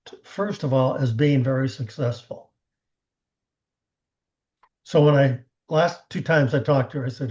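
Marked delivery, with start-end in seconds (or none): none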